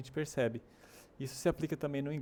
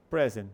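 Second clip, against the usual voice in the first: "present" as PREZ-ent, loud opening; first-syllable stress; 3 semitones lower, 6 dB louder than usual. Shown as a demonstration. Teacher introduces the second example, "present" stressed at the start. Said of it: "A ênfase tá no começo".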